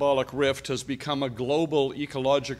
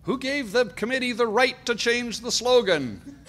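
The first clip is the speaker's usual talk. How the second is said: high-pitched mock outrage, sustained to the end; indignant objection; 9 semitones higher than usual. Speaker voicing Comintern members objecting to the new slogan, mock-indignant. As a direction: act out an affronted objector, voice pitched up. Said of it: "objected to that change".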